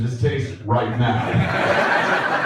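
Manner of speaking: "right now" drawn out slowly; slow, amused stress on "right now"; pointed repetition. Real, not acted. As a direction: stretch "right now" as a teasing qualifier. Pointedly repeats the other speaker's qualifier.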